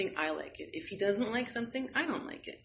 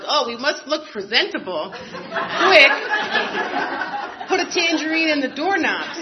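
high, loud, laughing through it; mock hurry, giggling, to put a tangerine in the doorknob; laughing mock panic; 8 semitones higher, 9 dB louder than usual. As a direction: play the frantic host while cracking up. Mock panic before guests arrive.

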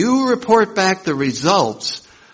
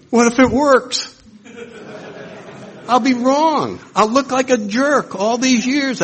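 much higher, anxious ego; ego's worried voice; 11 semitones higher than usual. Retold or imitated. Ego fearing prayer works, high worried voice. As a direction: squeak out the ego's fear.

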